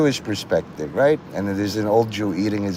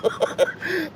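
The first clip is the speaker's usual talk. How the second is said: high laugh; 5 dB quieter than usual. Real, not acted. Laughing after quote.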